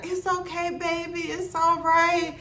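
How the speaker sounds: put-on gently forgive like a sweet elderly lady; old lady soothing. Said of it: "And she's like".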